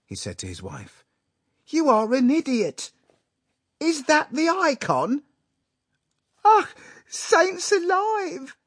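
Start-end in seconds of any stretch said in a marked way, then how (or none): 1.7-5.2 very high, loud, outraged husband; angry. A narrator's reading. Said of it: "his face was contorted with anger". 6.4-8.6 very high, loud, drawn-out exclamation; aghast. A narrator's reading. Mama sees her blunder.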